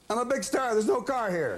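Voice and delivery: high-pitched, frantic mimicry of a stranded star; indignant star imitation. Guest mimics Regis finding no car outside, high and frantic. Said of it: "he kept running up and down saying".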